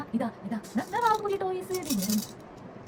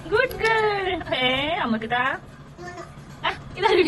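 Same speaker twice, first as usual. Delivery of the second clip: high, loud delighted coaxing; loud high praise for the little one; 7 semitones higher, 5 dB louder than usual. Real, not acted. Praising, calling baby.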